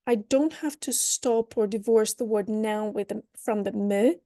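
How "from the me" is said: normal talking voice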